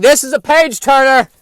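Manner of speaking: loud, high mock enthusiasm; enthusiastic Dune reader. Imagined guy reading Dune, mock-enthusiastic exclamation. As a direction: exclaim it like a hammed-up, thrilled reader. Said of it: "reading Dune going".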